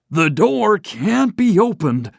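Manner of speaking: put-on an old banker in dismay; groaning old banker. A narrator's reading. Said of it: "he groaned".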